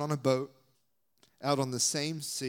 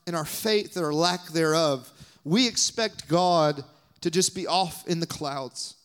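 normal talking voice

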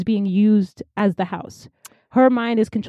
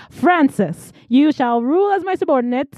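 higher-pitched, lightly hammed-up decree; mock villain proclamation; 6 semitones higher than usual. Villain addressing Francis as his subordinate, mock proclamation. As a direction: play at a villain issuing orders.

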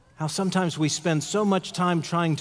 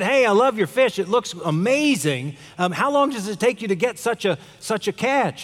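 lightly acted excitement, higher pitch; excited tourist; 5 semitones higher than usual. Tourist admiring a fisherman's fresh catch, excited. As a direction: gush a little, like an impressed tourist. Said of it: "he got all excited".

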